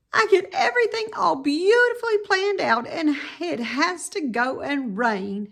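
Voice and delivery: high, fast exasperated cry; young pig's wailing complaint; Wilbur's frustrated outburst. A narrator's reading. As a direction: let it burst out in dismay, half laughing. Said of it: "he said".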